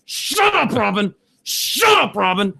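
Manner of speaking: high-pitched, exasperated yell. Retold or imitated. Viewer fed up with morning show host, yelling. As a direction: re-enact yelling at the television, voice shooting up. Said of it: "I, I yell".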